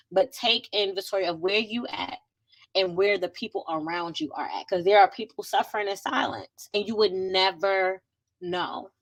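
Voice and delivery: ordinary talk, nothing marked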